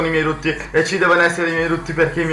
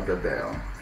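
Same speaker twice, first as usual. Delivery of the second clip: deep, soft belched word; burping the words; 11 dB quieter than usual. Acted. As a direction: burp the word out low. Ends video with a burp.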